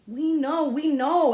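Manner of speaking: put-on high, loud impatient kids. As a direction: cut him off wearily.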